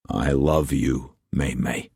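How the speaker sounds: slow, soft declaration of love; tender words to a frightened lover. A narrator's reading. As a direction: say it slowly and softly to calm her. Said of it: "he said softly".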